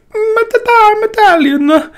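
put-on a silly high-pitched Italian accent in jest; mock Italian accent. Vlogger mimics the Italian lady who recommended the machine, in a mock Italian accent. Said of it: "which is totally not how Italians speak".

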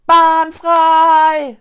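high, drawn-out warning shout; full-throated yell. A narrator's reading. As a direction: holler to clear the way. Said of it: "schrie Linus aus vollem Hals". (aus vollem Hals schreiend)

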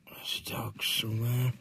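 breathy whisper. Real, not acted. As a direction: whisper so nobody wakes. Whispering in the morning.

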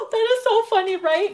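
gasp, then blurt it out laughing; real surprise; delighted gasp. Real, not acted. Gasps at the card meaning.

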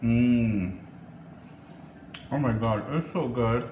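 talking through a bite; words round a mouthful of food; mouth full. Real, not acted. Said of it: "I'm always conscious about eating and talking on camera".

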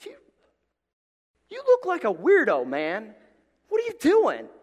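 high, loud, mocking; mocking jab. Cashier imagining taunting a punk, high and loud. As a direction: taunt him to his face. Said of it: "I really wanted to just say to him".

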